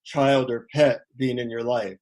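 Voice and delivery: low, sincere gratitude; heartfelt thanks. Shown as a demonstration. Speaker shows listeners how to thank loved ones, heartfelt. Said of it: "try to feel your heart and say".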